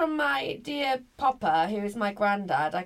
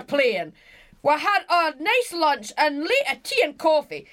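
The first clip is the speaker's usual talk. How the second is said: put-on loud, higher Glasgow accent, reading out; hammed-up Glasgow accent, high and emphatic; granddad's Glasgow accent; 5 semitones higher, 4 dB louder than usual. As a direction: read it as a Glaswegian granddad. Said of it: "do it in his accent".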